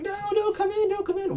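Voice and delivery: squeaky falsetto warning; a terrified hush-scream; mock panicked falsetto; high frightened plea. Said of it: "She's like".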